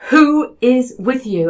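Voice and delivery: high, slow, loud sudden question; abrupt question. Retold or imitated. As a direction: ask it like a medium startled by a presence. Girl in circle asks suddenly.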